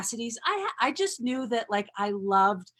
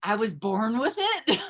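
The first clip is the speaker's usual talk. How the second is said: laughing. Retold or imitated. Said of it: "And I'd be like".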